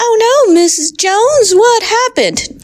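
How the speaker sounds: melodramatic worried coworker; high soap-opera alarm; shocked colleague. Acted. Colleague in teacher drama.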